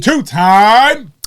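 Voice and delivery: loud, high ringside-style yell; hype-man shout. Pretend. Butting in on the champion's titles, loud hype shout.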